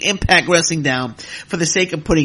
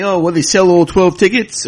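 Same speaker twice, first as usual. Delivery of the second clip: sneering mock quote of a naysayer, higher and louder; mocking critics; 6 semitones higher, 7 dB louder than usual. Podcaster mocking wrestling critics, sneering mock quote. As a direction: mimic the doubters with scorn. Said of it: "idiots that are coming out saying".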